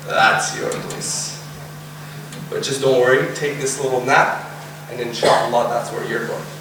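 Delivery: low, soothing voice; gentle reassurance. Acted. Angels showing the soul its place, gentle reassurance.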